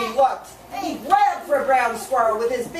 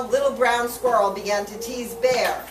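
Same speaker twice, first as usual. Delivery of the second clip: usual speaking voice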